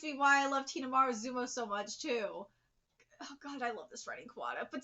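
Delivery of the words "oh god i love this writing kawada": usual speaking voice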